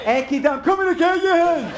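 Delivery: very high, rising character shout; calling out. Acted. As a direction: holler it at someone you're after.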